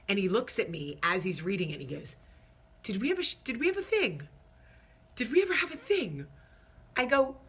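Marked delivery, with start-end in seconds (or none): 2.8-6.3 mock innocent husband. Husband feigning innocent confusion.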